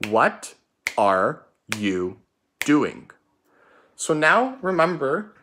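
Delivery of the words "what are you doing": slow, word by word choral repeat. Shown as a demonstration. Teacher leading pupils to repeat the sentence slowly. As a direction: lead the class through it slowly. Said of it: "Get ready".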